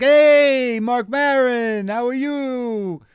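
high, loud, drawn-out cheery hello; Larry's greeting. Retold or imitated. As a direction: stretch the name out in a big sing-song greeting. Larry greets him at audition.

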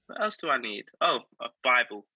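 ordinary delivery

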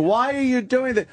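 high-pitched plea, imitating a crying owner; retold tearful protest. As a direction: mimic an upset owner pleading. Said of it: "they're crying and saying".

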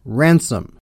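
"ransom" in two syllables, RAN-sum; local British pronunciation. Shown as a demonstration. Showing how the English say it, "ransom" as RAN-sum. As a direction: shrink the long name to "ransom", RAN-sum. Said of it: "which is pronounced".

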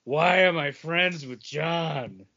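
slow, exasperated mock Paul; imitating Paul. Cohost mimicking Paul's exasperation, slow and weary. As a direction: drag it out in mock despair. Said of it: "Paul's like".

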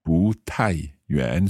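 slow 不太远 with 不 rising then 太 falling; tone demo. Shown as a demonstration. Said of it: "the second and then the fourth".

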